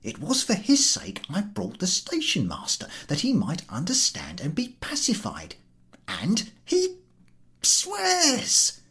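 puzzled at their ingratitude; bewildered. Acted. Podyakin thinking to himself, bewildered.

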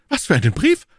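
animated, urgent exclamation; lively cry. A narrator's reading. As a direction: jump on the news, voice leaping up. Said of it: "rief Aramis lebhaft".

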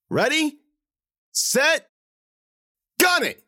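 high, loud ready-set-go shout; shouted countdown. A narrator's reading. Rufus shouting the countdown to Aiko, loud.

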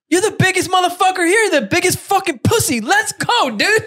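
high mocking jeer; high jeering taunt at the big friend; taunting yell. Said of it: "I'm like".

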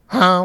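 short high goose call; distant goose honk. Retold or imitated. Goose answering campers across the lake, high distant honk. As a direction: imitate a faraway goose honking. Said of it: "From, like, way far off".

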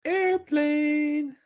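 high squeaky exclamation. Pretend. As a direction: chirp it up high. Mock voice naming the plane.

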